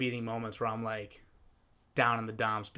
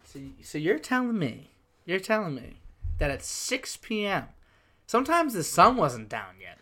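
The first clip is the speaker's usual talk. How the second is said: higher-pitched, incredulous questioning; real disbelief; 6 semitones higher than usual. Real, not acted. Host questioning guest's six P M bedtime, incredulous. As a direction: check an unbelievable claim, pitched up.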